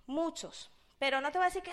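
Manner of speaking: ordinary talk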